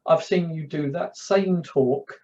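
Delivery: ordinary delivery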